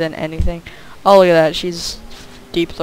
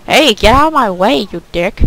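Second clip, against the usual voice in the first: mock rude lady, a bit louder; imitating lady's voice; 4 semitones higher, 7 dB louder than usual. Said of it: "that's, like, her voice".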